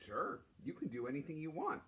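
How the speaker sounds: ordinary delivery, nothing marked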